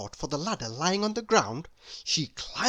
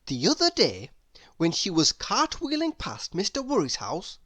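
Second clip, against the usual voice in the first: ordinary delivery, nothing marked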